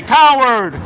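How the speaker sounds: yelling coward, loud and high; furious yelling at a rival who wronged him; angry shout. Real, not acted. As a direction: spit the word out with contempt.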